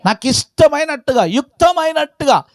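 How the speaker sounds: high, ringing pulpit repetition; impassioned preaching. Acted. Preacher repeating God's will to shape as He pleases, impassioned. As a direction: lift "ఇష్టమైనట్టుగా" high and proclaim it.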